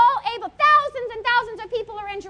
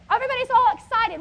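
normal talking voice

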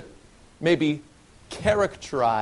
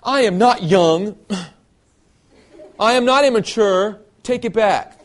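whiny, indignant pout, higher and louder; pouting complaint; 5 semitones higher, 7 dB louder than usual. Retold or imitated. Youth pouting back at people who call him immature. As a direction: sulk like an offended kid. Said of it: "to pout and complain".

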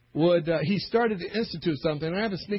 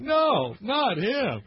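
mock dismay; 8 semitones higher, much slower than usual. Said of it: "are going".

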